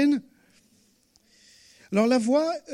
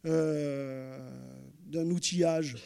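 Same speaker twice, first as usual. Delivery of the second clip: ordinary talk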